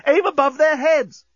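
high, loud panicked order; alarmed yell. Acted. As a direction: shout it in alarm. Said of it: "yelled Corporal Inchby Wiglet in alarm".

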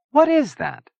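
high, emphatic question; puzzled question. A narrator's reading. Chia asking Zona about the walled city, puzzled. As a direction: press to find out.

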